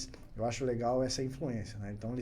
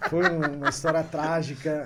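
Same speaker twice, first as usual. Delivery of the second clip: ordinary delivery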